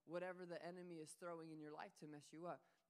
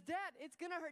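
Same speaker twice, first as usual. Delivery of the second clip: much higher, louder plea; scared son; 9 semitones higher, 5 dB louder than usual. Retold or imitated. Son pleading with his dad in fear. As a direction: beg in fear. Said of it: "he says".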